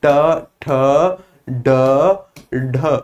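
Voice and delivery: ट, ठ, ड, ढ spaced out one at a time with pauses; slow sound drill. Shown as a demonstration. Teacher drills the retroflex sounds, slow and separate. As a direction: tap out each retroflex sound alone. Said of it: "ट, ठ, ड, ढ बोलने में".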